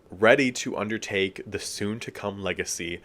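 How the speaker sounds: ordinary talk, nothing marked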